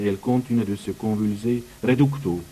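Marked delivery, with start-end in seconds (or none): none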